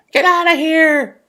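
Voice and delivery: high, loud angry yell; angry bear roar. Acted. Woken bear yells at party guests, angry and loud. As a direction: roar them out of the den. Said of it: "He says".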